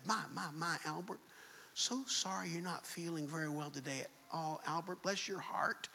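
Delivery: a light hint of a cooing old lady; sweet old lady. Old lady in produce cooing to the crying boy, lightly voiced. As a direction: coo softly at the child like a kind grandma. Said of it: "pats this little boy on the head".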